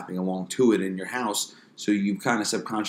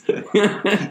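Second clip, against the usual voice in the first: laughing; 11 semitones higher, 5 dB louder than usual. Real, not acted. Keeps laughing.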